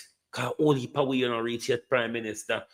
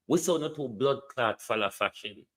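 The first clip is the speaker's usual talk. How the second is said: ordinary talk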